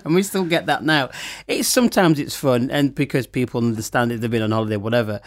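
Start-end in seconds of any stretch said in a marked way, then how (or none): none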